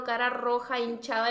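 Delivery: ordinary delivery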